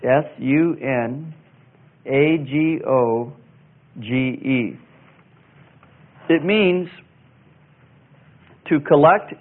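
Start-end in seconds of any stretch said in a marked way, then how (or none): 0.0-4.8 each letter slow and separate; spelled out letter by letter. Shown as a demonstration. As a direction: spell it out slowly for the class. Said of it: "That's, uh".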